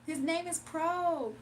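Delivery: "Pro" drawn out slowly; slow and firm, as if correcting; slow insistence. Retold or imitated. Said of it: "She was like".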